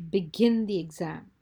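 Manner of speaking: loud, stern order; furious command. A narrator's reading. Glaring teacher to the exam class, with fury. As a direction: order the class to start, firmly angry. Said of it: "with fury on the class".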